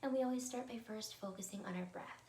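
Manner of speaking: ordinary talk, nothing marked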